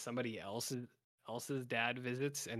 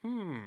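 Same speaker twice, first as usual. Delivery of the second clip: thoughtful hmm; 9 semitones higher than usual. Retold or imitated. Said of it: "And he's like".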